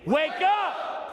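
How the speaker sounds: high shouted wake-up call; booming motivational speech. Acted. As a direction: shout it to the crowd. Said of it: "Say it again".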